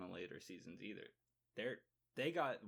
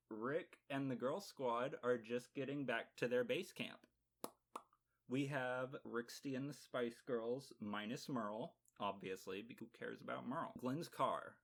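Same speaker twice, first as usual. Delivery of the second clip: ordinary delivery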